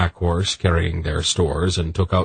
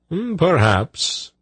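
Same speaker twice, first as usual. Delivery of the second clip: slow, high, musing voice; murmuring eunuch; 11 semitones higher, slower than usual. A narrator's reading. Eunuch answering Silk's doubt, musing murmur. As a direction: draw it out high and noncommittal. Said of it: "the eunuch murmured".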